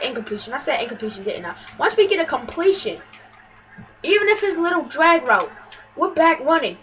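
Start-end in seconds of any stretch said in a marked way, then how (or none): 0.0-1.5 much softer, lower self-correction; muttered aside. Real, not acted. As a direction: mumble it to yourself.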